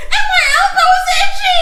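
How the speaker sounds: put-on very high whiny child wail with a laugh; dramatic little girl. Mother imitating her daughter's lice drama, high whiny child voice. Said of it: "she's like".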